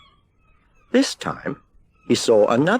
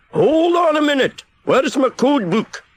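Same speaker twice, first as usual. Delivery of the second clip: panicked keeper shouting for his code book; 5 dB louder than usual. A narrator's reading. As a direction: call out in a flap. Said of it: "he shouted".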